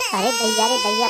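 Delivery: hammed-up wail over the saree price; wife cries theatrically over a costly saree; mock crying wail. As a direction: fake sob on दैया, stretched and tearful. Wife wails over the expensive saree.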